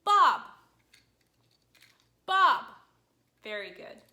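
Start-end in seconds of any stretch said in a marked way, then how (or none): 0.0-2.6 "Bob" loud, starting high and falling sharply; emphatic, steeply falling "Bob"; fourth tone. Shown as a demonstration. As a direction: slash down hard on "Bob". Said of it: "It's a tone that comes down".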